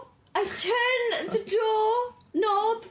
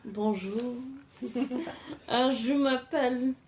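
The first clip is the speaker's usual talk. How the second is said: put-on French accent; 10 semitones lower, 4 dB quieter than usual. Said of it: "I am the French one".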